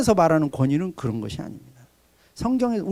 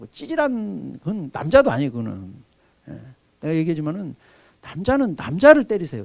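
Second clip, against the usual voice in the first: normal talking voice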